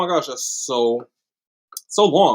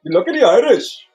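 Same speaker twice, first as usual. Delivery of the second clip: louder, higher stab at a Scottish accent; attempted Scottish accent; 5 semitones higher, 4 dB louder than usual. Shown as a demonstration. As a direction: try a Scottish accent, pitched up. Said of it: "I could try my Scottish accent".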